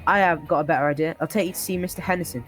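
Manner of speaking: normal talking voice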